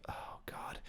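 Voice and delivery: hushed, breathless whisper; whispered aside. Real, not acted. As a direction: drop to a whisper as if sharing a secret. Aside about occult convention.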